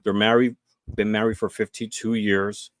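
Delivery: ordinary talk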